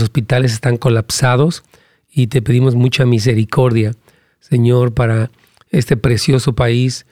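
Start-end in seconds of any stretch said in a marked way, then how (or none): none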